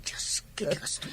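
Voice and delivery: whispered aside under her breath. Acted. Young woman whispering in dismay to herself. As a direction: breathe it out so auntie won't hear. Shocked aside about pregnancy.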